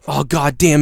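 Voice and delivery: usual speaking voice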